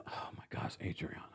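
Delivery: breathless whisper of disbelief; whispered exasperation. Real, not acted. Host muttering about the castmate's exaggeration, whispered exasperation. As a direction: whisper it under your breath. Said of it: "unvoiced aside".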